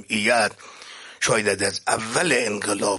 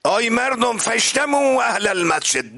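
calling out; 13 semitones higher, 3 dB louder than usual. Retold or imitated. Preacher as the Prophet calling the mosque's people, high ringing cry. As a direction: cry out to gather a crowd, imitating the Prophet. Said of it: "داد زد، همهٔ اهل مسجد".

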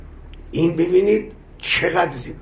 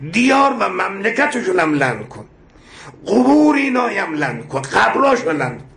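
fervent prayer; 4 semitones higher than usual. Acted. Preacher leading the congregation in the curse prayer, impassioned. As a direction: call down the curse like a preacher at full force.

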